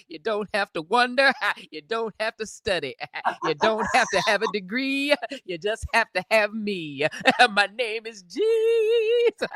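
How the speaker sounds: preaching with rising fervor; rally the church. Acted. Jesus preaching to the listeners, with fervor. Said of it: "You're preaching good".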